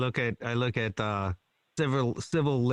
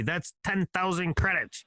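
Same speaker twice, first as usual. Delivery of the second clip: alien voice; 5 semitones higher than usual. Retold or imitated. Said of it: "Is that your alien voice?".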